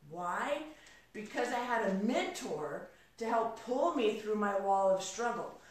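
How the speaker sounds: ordinary delivery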